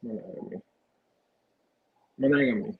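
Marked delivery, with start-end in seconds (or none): 0.0-0.6 barely voiced answer. Real, not acted. Answering the host, "Monogamy." comes out breathy and nearly silent.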